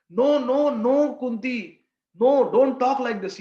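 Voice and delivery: urgent protest. Acted. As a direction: insist earnestly, voice up and pushed. Brahmin lady protests to Kunti.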